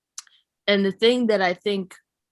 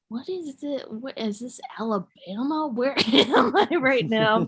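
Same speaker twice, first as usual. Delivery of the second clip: high-pitched, acted incredulity; mock indignant disbelief; 5 semitones higher than usual. Her acted reaction to the heckler.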